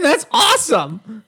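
mock excited, slow and high; sarcastic. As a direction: fake enthusiasm, drawn out.

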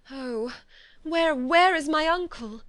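high, loud desperate cry for her uncle; anguished cry. A narrator's reading. Elizabeth crying out for her uncle after the letter, anguished. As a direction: cry out in panic, drawn out. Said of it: "cried Elizabeth".

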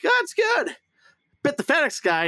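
high-pitched voice for a retold dog; squeaky-high acted dog reply; chatty dog character. Acting out the dog's chatty reply.

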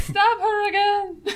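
high, laughing repeat of the suggestion. Real, not acted. Player laughing as the GM ignores her, high giggle. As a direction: giggle it out the second time.

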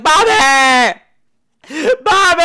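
hammed-up high, loud cries of a name; mock a bad actress yelling for her husband; mock screaming wife. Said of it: "She's like".

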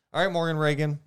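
usual speaking voice

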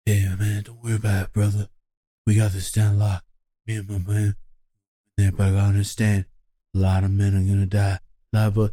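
low, breathy tough-guy mumble; breathy cool-guy Seagal impression. Mocking Steven Seagal's breathy tough-guy voice for friends. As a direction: murmur it like a laid-back action hero. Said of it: "breathy talking, cool shit".

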